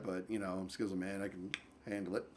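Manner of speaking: soft, low muttered aside; quiet aside. Real, not acted. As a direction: tuck it away under the breath. Self-mocking aside.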